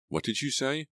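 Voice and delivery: high, shocked question; gasped question. A narrator's reading. Natalie hearing Keith knows her secret, gasping in shock. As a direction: jump up in pitch, stunned. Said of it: "I gasped".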